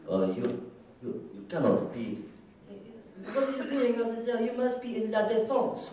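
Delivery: hammed-up French accent, rising high and pompous; mock French official scolding. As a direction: play a snooty French bureaucrat, lightly. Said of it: "Some government official said".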